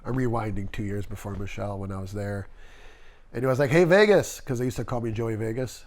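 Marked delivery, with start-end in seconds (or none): 3.7-4.4 raised, higher hail by nickname; calling out. Retold or imitated. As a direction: call over a coworker, imitated. Boss calling him by nickname.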